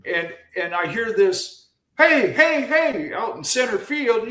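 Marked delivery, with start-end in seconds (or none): 2.0-3.1 high, loud calling out; mock yell to get someone's attention; retold scream. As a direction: imitate hollering across a ball field. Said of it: "He's screaming at me".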